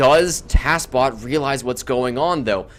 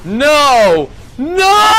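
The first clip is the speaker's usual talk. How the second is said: rising, much louder and far higher no's; anguished shouts; 8 semitones higher, 8 dB louder, much slower than usual. Real, not acted. Caster to viewers as the snipe lands, anguished shouting. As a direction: yell in disbelief as it dies. Commander sniped second time.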